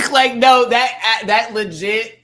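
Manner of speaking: high, loud through laughter; real laughing exclamation; laughing outburst. Real, not acted. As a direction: burst out between laughs.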